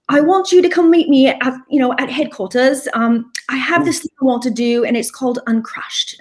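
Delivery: high-pitched, hammed-up bad British accent; a deliberately terrible British accent, played for laughs; Tim in a terrible British accent. Retelling Tim's phone call in a bad British accent. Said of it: "in my terrible British accent".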